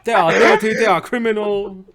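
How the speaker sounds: mock Korean accent, laughing; hammy Korean accent. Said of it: "Why are you doing Korean accent?".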